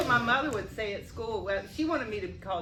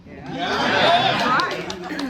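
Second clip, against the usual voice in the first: laughing; 9 semitones higher, 12 dB louder than usual. Real, not acted.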